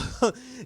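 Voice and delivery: short amused laugh. Real, not acted. Storyteller amused by the summer school quip, laughing. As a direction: let out a quick, high laugh.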